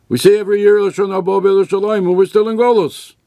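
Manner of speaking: loud, high protest to God; anguished outcry. Acted. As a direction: cry out that they're still in exile. Rabbi cries out in protest.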